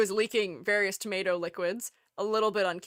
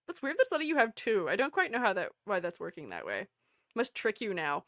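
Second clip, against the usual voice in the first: ordinary talk, nothing marked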